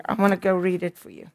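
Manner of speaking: ordinary delivery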